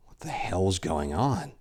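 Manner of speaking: slow, breathless whisper; a priest alone in the dark woods, whispering in fear; frightened whisper. A narrator's reading. Priest's frightened inner thought.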